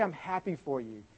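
hushed, sincere reply; softly glad for someone; gentle congratulation. Retold or imitated. Friend's kind reply, repeated.

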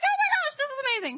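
very high, loud shriek of joy; imitated excited squeal. Chapter hearing the news, squealing with excitement. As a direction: squeal like thrilled friends. Acted friends' thrilled reaction.

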